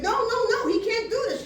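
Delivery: high, insistent re-enacted objection; urgent protest. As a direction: protest loudly, pitched high. Acting out her protest to partner.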